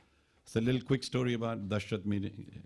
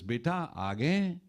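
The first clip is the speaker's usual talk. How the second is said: slow, higher questioning call; parent calling son; 4 semitones higher, much slower than usual. Retold or imitated. Blind parent asking if son returned, calling. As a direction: lightly voice a parent, stretching the words upward.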